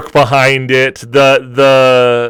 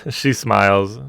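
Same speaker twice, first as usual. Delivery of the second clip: ordinary talk, nothing marked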